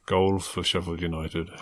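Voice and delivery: low, slow, subdued stadium announcement; sombre announcer. Retold or imitated. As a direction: announce it as gloomily as you can. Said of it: "the most sombre voice he can".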